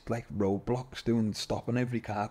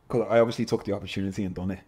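usual speaking voice

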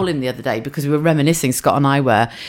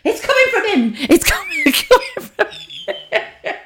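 much higher voice, breaking into laughter; mum, baffled; slower than usual. Retold or imitated. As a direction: accuse the baby of making the noise. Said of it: "she's going".

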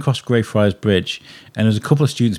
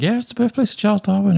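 much higher, bright show-off announcement; proud announcing; 11 semitones higher than usual. Retold or imitated. Telling American radio listeners about his hometown, high proud announcement. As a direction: announce it like big news to impress. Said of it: "be like".

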